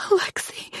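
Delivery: breathless frightened whimper of his name. A narrator's reading. Hiding in the tub, she whimpers his name into the phone. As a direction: barely voice it, scared. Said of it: "I whimper".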